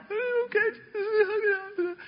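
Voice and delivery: high, very slow teary reply; mock weepy disciple. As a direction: drag it out, pitched high and whimpering. Disciples mock-agree to a hug.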